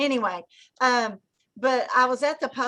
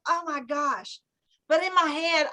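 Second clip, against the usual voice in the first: normal talking voice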